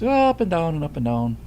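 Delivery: "up and down" said in a swooping lilt; lilting Irish-style melody on "up and down"; sing-song. Shown as a demonstration. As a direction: make "up and down" rise and fall with the words. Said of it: "it's a lot more sing-song".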